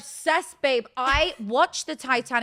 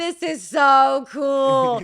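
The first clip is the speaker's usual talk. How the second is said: loud, drawn-out gush of delight; thrilled; 4 semitones higher, 11 dB louder, much slower than usual. Retold or imitated. British newcomer retelling love of country music, thrilled. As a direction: gush it slowly at full volume.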